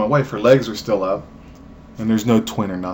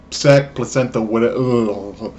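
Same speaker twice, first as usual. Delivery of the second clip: ordinary talk, nothing marked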